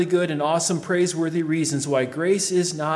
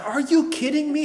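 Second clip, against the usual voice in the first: all caps; 10 semitones higher than usual. Acted. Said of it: "it would be all caps and in extremely large font".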